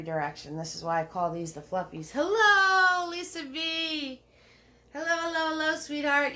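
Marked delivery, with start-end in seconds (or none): 2.1-6.3 high, bright-toned hello to a fan; sing-song greeting. Real, not acted. Host calls out to Lisa V joining the stream, high sing-song hello.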